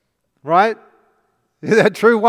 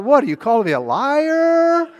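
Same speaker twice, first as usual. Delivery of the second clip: mock offended; 8 semitones higher than usual.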